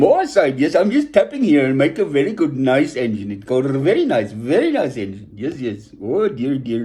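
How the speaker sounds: cheerful imitation of an Indian accent, fading on the last dear. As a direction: play the film's Indian engine driver in a mock Indian accent. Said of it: "And he's saying".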